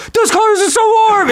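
put-on gushing voice, way up high; mock gushing. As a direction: squeal it like an overexcited fan of warm colors. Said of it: "you'll be like".

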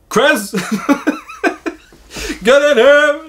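uncle's high, loud call from the kitchen; mock yell. Prankster uncle calls the boy into the kitchen, mock yelling. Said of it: "and he goes".

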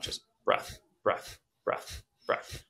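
quiet, unvoiced, popping exhales; sharp nose exhales. Shown as a demonstration. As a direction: blow each one out hard. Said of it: "sharp breaths blowing out".

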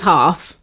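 half as "hahf", low and broad; British half. Retold or imitated. Daughter showing dad's word, British half as "hahf". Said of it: "He says "hoff".

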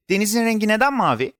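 put-on high, eager child's question; curious child. A narrator's reading. Boy asking his friend Deniz about the sea, curious. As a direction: ask it high, full of wonder.

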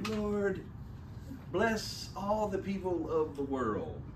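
mock small child praying sweetly. Parent overhears a child praying at bedtime, lightly imitated child voice. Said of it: "heard, overheard them praying".

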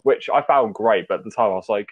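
usual speaking voice

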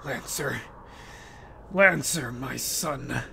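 low, soft, menacing king; king father. Acted. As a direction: speak down to his son coldly.

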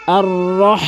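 রহ with a strong breathy ha from the throat; heavy ha. Shown as a demonstration. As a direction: chant আর রহ, stressing the big ha. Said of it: "এখানে বড় হা রয়েছে". (রহ-তে বড় হা স্পষ্ট উচ্চারণ)

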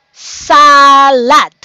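"salad" drawn out in two beats, saa-lad; syllable by syllable. Shown as a demonstration. Teacher reading word list with kids, syllables drawn apart. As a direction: stretch and separate both syllables of "salad". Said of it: "let's read out loud the following word".

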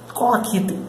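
Colaquite said KOH-lah-KEE-teh, clear model; right pronunciation of collect. Shown as a demonstration. Teacher contrasts the right Colaquite with the wrong collecte, clear. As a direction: model Colaquite carefully as the correct form. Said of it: "é a pronúncia dessa palavra".

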